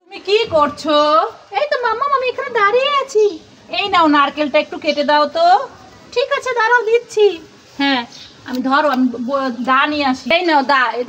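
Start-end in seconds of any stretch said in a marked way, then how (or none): 1.6-3.2 put-on higher-pitched pouty kid voice; sulky child character. Mother voicing a toy child who sulks off, higher pouty voice. 6.1-7.2 put-on higher-pitched pouty kid voice; sulky child character. Mother voicing a toy child who sulks off, higher pouty voice.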